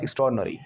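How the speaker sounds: एक्स्ट्राऑर्डिनरी as ik-STROARD, nuh-ree; right pronunciation. Shown as a demonstration. Teacher showing how to say it, merged pronunciation. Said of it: "आपको बोलना है".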